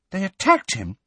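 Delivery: high, startled question; shocked. A narrator's reading. Hermione hears of the attack on Firenze, shocked. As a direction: recoil in disbelief. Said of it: "sounding shocked".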